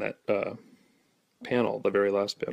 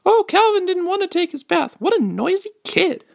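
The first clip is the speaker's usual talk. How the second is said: very high falsetto mom; put-on squeaky falsetto mother; 4 dB louder than usual. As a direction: play the mom in a piping high voice. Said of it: "I'm gonna do voices now".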